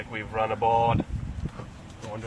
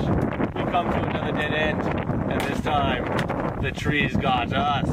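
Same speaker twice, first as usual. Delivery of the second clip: heroic survival-show narration, a bit higher; documentary narrator; 3 semitones higher than usual. Acted. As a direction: narrate like a bold wilderness host.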